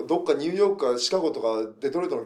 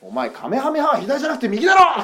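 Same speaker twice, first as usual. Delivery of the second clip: higher, louder playful telling-off; mock scolding shout; 10 semitones higher, 8 dB louder than usual. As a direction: scold the kid in fun. Scolding the kid.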